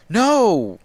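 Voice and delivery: high, loud shout as she strikes; anguished cry. Acted. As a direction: scream it in horror. Illyana cries out.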